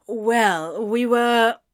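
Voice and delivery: hesitant, unfinished admission; halting character voice, left open; trailing off. A narrator's reading. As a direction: start to explain, then leave it hanging. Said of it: "Anthony trailed off".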